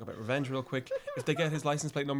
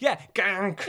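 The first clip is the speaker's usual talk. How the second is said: ordinary delivery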